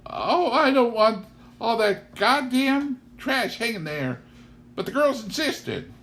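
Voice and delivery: shy farm wife apologizing for the decorations; flustered Mrs Kettle. Acted. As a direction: blurt it out, then try to cover. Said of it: "she said".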